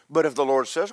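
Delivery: ordinary talk, nothing marked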